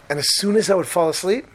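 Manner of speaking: ordinary talk, nothing marked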